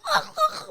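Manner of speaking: very high-pitched frantic vocal noise; mock crazed cry. Acting out going crazy on the back machine, mock frantic cry. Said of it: "like, going crazy".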